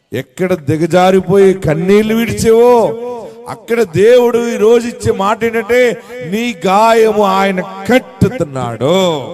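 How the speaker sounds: loud declaration, slowing at the close; forceful preaching. Real, not acted. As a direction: drive the promise home, stretching the final phrase.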